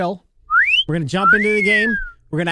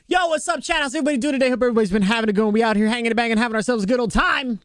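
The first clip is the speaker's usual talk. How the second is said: streamer intro; 4 semitones higher, faster than usual. Acted.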